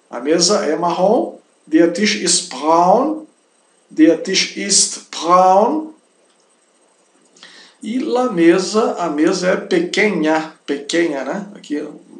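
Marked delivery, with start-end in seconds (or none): 1.7-5.9 slow, careful enunciation with a long sh; slow German model. Shown as a demonstration. Teacher modelling "the table is brown", slow careful German. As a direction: space out each German word for learners.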